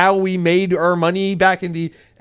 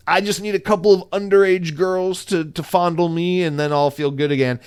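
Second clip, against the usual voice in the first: mock Southern accent; hammed-up Southern man confiding his wants; Satya Nadella.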